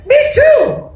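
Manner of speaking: very high, squeaky joey voice; young kangaroo. Acted. Young kangaroo backs up mother, high squeak. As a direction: squeal it like a tiny echo. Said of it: "the young kangaroo in her pouch said".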